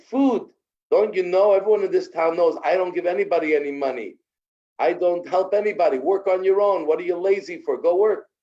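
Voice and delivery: loud, high, fast angry refusal; contemptuous yell. Acted. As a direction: scoff at him and throw him out. Rich man refuses the beggar.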